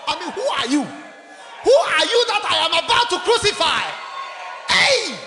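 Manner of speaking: Pilate's questions rising to a shout; exasperated governor building to a shouted hey; Pilate demanding. Acted. Pilate interrogates Jesus.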